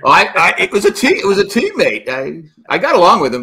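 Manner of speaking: loud, high, stumbling self-defence; defensive protest. Real, not acted. Protests being teased about grudge.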